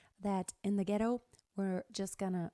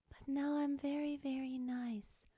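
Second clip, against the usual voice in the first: soft, breathy whispered sweetness; whispered sugary nice; 4 semitones higher, 4 dB quieter than usual. Shown as a demonstration. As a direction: coo it very nicely. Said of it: "extremely nice exercise".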